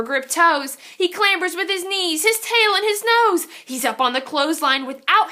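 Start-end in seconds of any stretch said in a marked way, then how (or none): none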